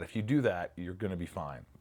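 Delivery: ordinary talk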